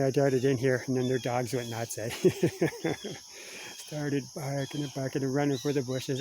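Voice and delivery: ordinary talk, nothing marked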